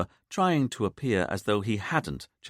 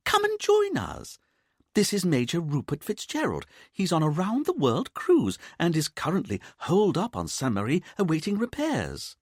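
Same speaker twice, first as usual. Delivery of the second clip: much higher, bright mother's voice; lively mother; 10 semitones higher than usual. A narrator's reading. Mother introducing her new friend to her son, breezy. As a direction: introduce him gaily.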